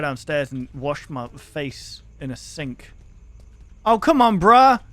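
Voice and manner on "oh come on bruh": loud, high complaint; exasperated protest. Real, not acted. Player protesting to the game, exasperated shout. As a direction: cry out in protest at unfairness.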